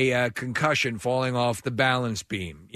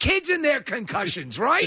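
put-on high-pitched, jokey child's remark; chirpy kid quip; 9 semitones higher than usual. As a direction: toss it out brightly to an annoyed dad. Joking to annoyed dad, acted.

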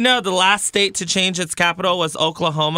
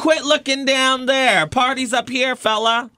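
higher, hammed-up sassy rebuff; mock sassy; 7 semitones higher than usual. Intern playfully scolding an imagined ogler, sassy. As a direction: wag a finger at the ogler.

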